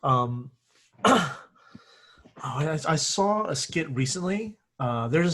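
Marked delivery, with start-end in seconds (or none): none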